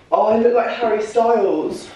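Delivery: admiring her own reflection; thrilled gasp. Real, not acted. A vlogger seeing her new look, high thrilled gasp. Sees herself in the outfit.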